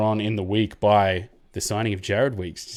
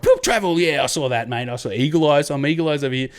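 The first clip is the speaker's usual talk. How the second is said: mock referee; 5 semitones higher, 4 dB louder than usual. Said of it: "they were just like".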